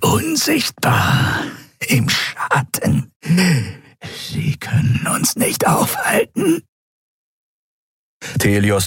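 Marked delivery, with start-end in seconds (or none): 0.0-6.6 much higher, slow, breathy creature taunt; reptile creature, eerie. Acted. Reptile prisoner taunting the admiral, eerie and high. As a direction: hiss it from the shadows.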